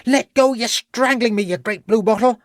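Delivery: put-on very high-pitched boyish protest; indignant yelp. Servant lad grabbed by the constable, high indignant yelp. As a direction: yelp it high, struggling to break free. Held servant struggles to get free.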